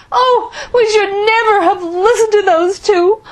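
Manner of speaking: high, loud tearful wail; wailing through tears, voice cracking; sobbing. A narrator's reading. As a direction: sob it out into a hanky. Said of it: "Mama sobbed into her hanky".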